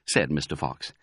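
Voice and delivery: usual speaking voice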